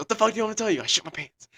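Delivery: high-pitched mock outburst, easing at the end; acted-out cornered husband cracking; retold exasperated confession. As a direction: give in and blurt it out. Retold confession under wife's pressure.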